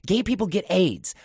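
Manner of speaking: high, loud warning; stern mom. Retold or imitated. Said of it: "is what she said".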